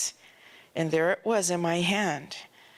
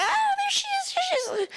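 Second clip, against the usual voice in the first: much higher, shrieking with delight; excited fan shriek; much faster than usual. Retold or imitated. Moviegoers spotting Pam on screen, delighted high shriek. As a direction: squeal it like thrilled fans spotting a star. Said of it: "people jumping up and screaming".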